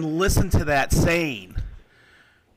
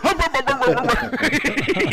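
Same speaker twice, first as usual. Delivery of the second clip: answering hum, louder and higher, mock-grumpy; grumpy hum reply; 11 semitones higher, 5 dB louder, slower than usual. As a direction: hum back like the other grumpy old guy. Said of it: "he looks at me and goes".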